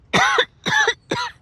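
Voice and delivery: hard real cough, bug stuck; coughing fit. Real, not acted. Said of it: "It's stuck in the back of my throat".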